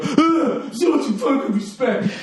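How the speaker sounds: high, laughing mockery of the heckler; mocking heckler. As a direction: sneer it high while laughing. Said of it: "This fucking".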